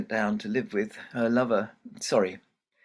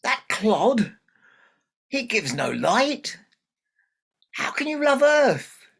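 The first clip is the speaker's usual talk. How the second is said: high, loud, slow outraged disbelief; father outraged at his daughter's choice; 12 semitones higher, 8 dB louder, slower than usual. Acted. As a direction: spit out his scorn. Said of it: "This made even Moon angry".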